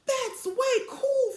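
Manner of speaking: high, slow, impressed big sister; high, slow way-cool exclamation; declaring. A narrator's reading. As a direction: drawl it out, really impressed. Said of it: "Frida declared".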